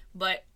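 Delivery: real coughing fit; coughing, choking on bile. Real, not acted. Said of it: "I was choking on my own bile".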